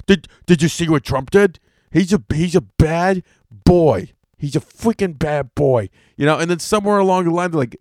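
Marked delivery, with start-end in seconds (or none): 0.1-5.9 mocking the other side. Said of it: "the other side is like".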